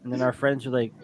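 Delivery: usual speaking voice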